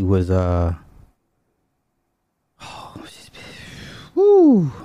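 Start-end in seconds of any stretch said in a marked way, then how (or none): 2.6-3.3 breathy hushed aside; whisper. Real, not acted. Telling his audience about his ex, in a whisper. 4.1-4.7 high loud admiring whoop; woo. Real, not acted. Recalling his ex's looks to his audience, loud high whoop.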